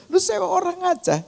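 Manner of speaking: very high-pitched imitated scolding; scolding older brother. Older brother tells her to hire someone else, high-pitched scolding. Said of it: "abang saya marahin dia".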